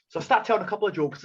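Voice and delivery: usual speaking voice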